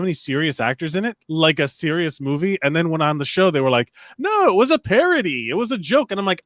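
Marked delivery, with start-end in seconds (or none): 4.2-6.1 high-pitched imitation of objectors; mock insistence. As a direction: correct them in a lifted mimicking voice. Mimics others insisting on the show.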